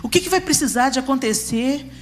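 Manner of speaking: fervent pulpit preaching; impassioned preaching. Acted. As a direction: drive it home to the congregation. Preaching with rising intensity.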